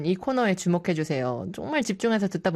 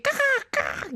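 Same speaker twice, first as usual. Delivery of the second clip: higher, squawky seagull call; seagull imitation. DJ mimicking a seagull for a listener. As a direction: mimic a cartoon gull.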